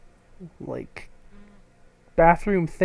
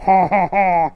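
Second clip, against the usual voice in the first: mock laugh; 6 dB louder than usual. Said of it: "we know that she is adding".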